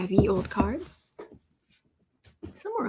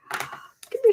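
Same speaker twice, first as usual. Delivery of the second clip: high sing-song coaxing call; 13 semitones higher, 3 dB louder than usual. Real, not acted. Coaxing the cat over.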